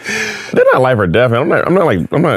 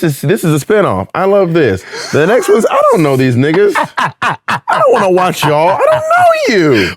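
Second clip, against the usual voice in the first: higher, laughing, mock-dismissive grumble; mock fed-up viewer; 9 semitones higher than usual. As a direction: laugh while brushing off strangers on screen. Bored heaven viewer mocking descendants.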